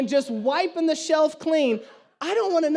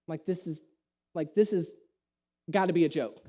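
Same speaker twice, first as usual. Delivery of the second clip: muttered disbelief; 8 semitones lower, 6 dB quieter than usual. Real, not acted. Disbelief at empty shelf.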